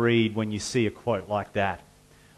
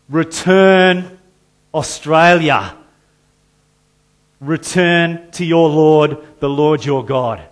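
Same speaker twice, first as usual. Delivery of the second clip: loud, higher, far-off call to return; God calling the nation; 4 semitones higher, 10 dB louder than usual. Acted. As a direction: cry it out as God's appeal to the nation. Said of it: "He's saying".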